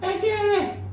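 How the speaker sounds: high-pitched scoff at something cheap; playful high-pitched belittling; mock disdain. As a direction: squeak it out dismissively, in jest.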